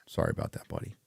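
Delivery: a breathy aside, barely any voice; whispered apology. Real, not acted. Host whispers an apology to the grieving fan.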